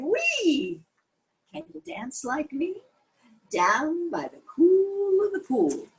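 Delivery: high, squealing little frog; high excited frog voice; excited frog cry. A narrator's reading. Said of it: "Frog cried".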